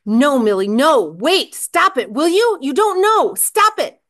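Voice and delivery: frantic shout. Acted. Husband frantically yelling at wife to stop burning books, desperate shout. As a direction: shout to stop her throwing the book in.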